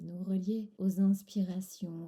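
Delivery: ordinary talk, nothing marked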